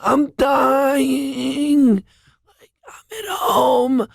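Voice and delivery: high, tearful, slow hammed-up moaning; fake dying sick voice. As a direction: play the dying patient to win the argument. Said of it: "you go".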